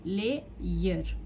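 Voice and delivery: "lay, year" as two separate syllables; split into syllables. Shown as a demonstration. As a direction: pause between "lay" and "year". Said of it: "मैंने divide किया इसको".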